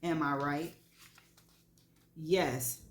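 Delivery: plain reading voice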